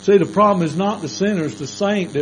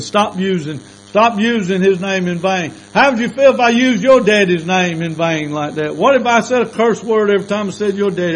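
louder, higher scolding; stern rebuke; 5 semitones higher, 7 dB louder than usual. Retold or imitated. Preacher rebuking the cursing coworker, a raised stern scold. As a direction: scold him hard.

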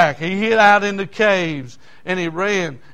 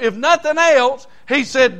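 ordinary talk

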